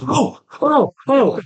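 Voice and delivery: high, exclaimed ohs; flustered realization, imitated. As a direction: imitate someone suddenly catching their mistake. Excited man realizing the book is Spanish.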